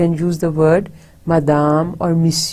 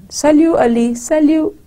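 "Salut, Ali" sung out high, like a greeting call; intonation demo; 10 semitones higher, 3 dB louder than usual. Shown as a demonstration. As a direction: pitch "Salut, Ali" well up and hold it. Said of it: "again the intonation".

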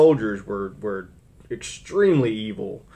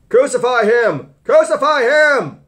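slow shout building much higher and louder; shouting crowd; 14 semitones higher, 8 dB louder than usual. Acted. Preacher voicing the mob's cry to crucify, building shout. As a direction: yell it like an angry mob. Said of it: "they cried out".

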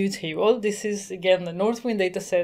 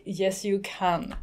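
normal talking voice

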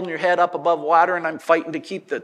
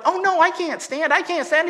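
high-pitched, protesting mock dismay; mock panic; 12 semitones higher, 4 dB louder than usual. Healed man doubting his legs as helpers lift him, mock panic. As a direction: play a man fretting that he can't do it. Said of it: "if the guy would have went".

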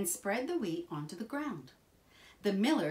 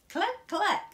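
high, louder hen clucks; clucking hen; 13 semitones higher, 5 dB louder than usual. A narrator's reading.

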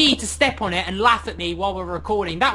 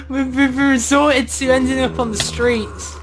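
mock crying; 5 semitones higher, 3 dB louder than usual. Mock lament over lost home.

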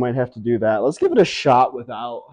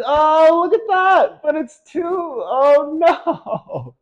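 high excited squeal; much slower than usual. Real, not acted. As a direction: shriek with hope, then despair. Throw almost works.

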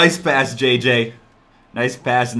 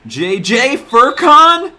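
excited call; 9 semitones higher, 9 dB louder, much slower than usual. Real, not acted. Calling a drive, drawn out.

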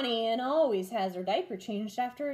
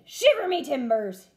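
louder pirate oath; pirate yell; 4 semitones higher, 7 dB louder than usual. A narrator's reading. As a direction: yell it in disgust. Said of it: "he yelled".

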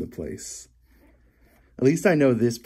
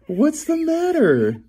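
high pet talk; slower than usual. Acted. Cooing at grumpy pet cat.

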